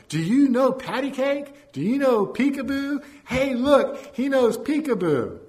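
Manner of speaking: very high, loud geezer holler; shouting across room. A narrator's reading. Drunken geezer shouts baby games across the room, high loud shout. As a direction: holler excitedly at a baby far off. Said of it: "drunken geezer began shouting across the room".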